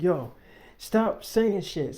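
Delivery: loud slow furious warning; yelling. Retold or imitated. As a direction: yell each word to shut her up. Kicks door, yells at friend.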